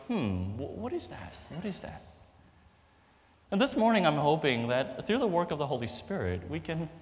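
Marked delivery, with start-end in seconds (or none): 0.1-2.0 puzzled. Retold or imitated. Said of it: "we're like".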